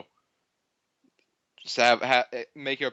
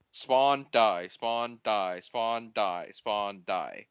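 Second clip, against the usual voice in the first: resigned demonstration; 4 semitones lower, much slower than usual. Shown as a demonstration. Demonstrating his experience of the game, resigned and sinking. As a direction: let each round fall further, fading away. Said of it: "I'm gonna quickly demonstrate my experience".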